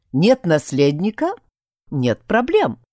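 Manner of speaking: ordinary delivery, nothing marked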